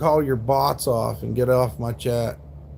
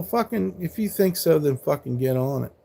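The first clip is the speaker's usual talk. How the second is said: normal talking voice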